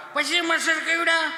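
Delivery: loud mock interrogation; mock shouted question. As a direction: bark the question like a policeman. Officer asks loudly, shout heard. (મજાકમાં પોલીસની જેમ મોટેથી પૂછવું)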